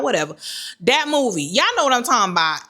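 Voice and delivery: ordinary talk, nothing marked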